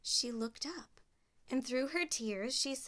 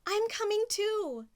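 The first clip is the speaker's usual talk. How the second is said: much higher, louder call; 12 semitones higher, 6 dB louder than usual. Acted. Cinderella calling to her family, high and loud. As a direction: call after them.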